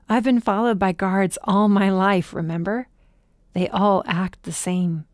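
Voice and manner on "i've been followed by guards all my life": ordinary delivery, nothing marked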